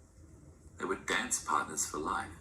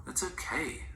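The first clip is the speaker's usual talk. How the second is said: reassuring; 9 semitones higher, slower than usual. A narrator's reading. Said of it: "I assured".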